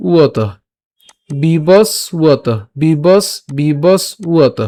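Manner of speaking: বিবস উয়ত slowly split, bibos then u-o-to. Shown as a demonstration. Teacher splits the conjunct, বিবস উয়ত with a u-o glide. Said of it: "উয়োর মতো হয়".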